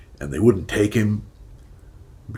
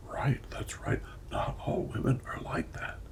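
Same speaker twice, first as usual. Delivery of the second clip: soft whispered nodding along; murmured agreement; 11 dB quieter than usual. Retold or imitated. Everyone nodding about women, whispered agreement. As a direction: hush it like a crowd agreeing. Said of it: "And everyone".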